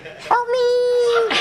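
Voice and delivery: very high, tiny squeaky plea; The Fly voice. Retold or imitated. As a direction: squeak like the little fly-man from the movie. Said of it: "I stood up and went".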